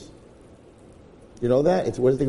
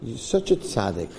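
coaxing Satan; 5 semitones lower than usual. Retold or imitated. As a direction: sweet-talk him into one sin. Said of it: "He says".

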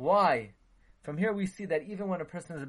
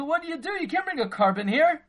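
high, loud scolding outburst; a prophet scolding a king, loud and high; angry rebuke; 10 semitones higher, 6 dB louder, faster than usual. Acted. Prophet scolding King Shaul, loud and high.

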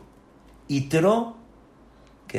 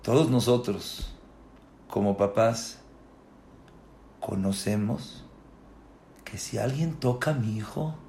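ordinary talk, nothing marked

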